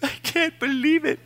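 high wailing whimper, imitating his own weeping; mock sobbing. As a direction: squeeze it out in a high crying voice. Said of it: "who heard me mourn".

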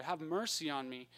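ordinary talk, nothing marked

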